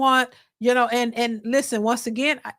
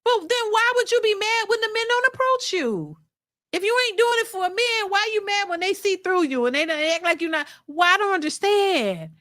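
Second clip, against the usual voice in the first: incredulous; 7 semitones higher than usual. Real, not acted. Incredulous questioning of women.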